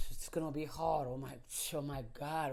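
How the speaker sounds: low, soft, overwhelmed moan; mock dread. Coach mimicking someone put off by a hard task, daunted. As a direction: shrink back as if daunted.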